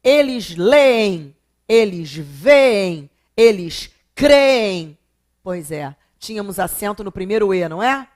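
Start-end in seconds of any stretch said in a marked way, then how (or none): 0.0-5.0 leem, veem, creem dragged out loud on the ê; very slow list, holding the ee of leem, veem, creem; stretched double E. Shown as a demonstration. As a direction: swell the first e of leem, veem, creem, then let it fall. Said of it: "E a dobradinha do E?".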